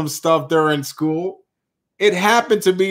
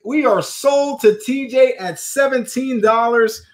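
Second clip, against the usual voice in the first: excited play-by-play; 7 semitones higher, slower than usual. Acted. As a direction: call it like a live sports moment. Announcing winning bid.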